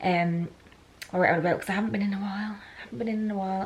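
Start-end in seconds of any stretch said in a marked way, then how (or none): 2.5-3.6 soft unvoiced whisper aside. Shown as a demonstration. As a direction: whisper it like a secret. Whispered repeat of own line.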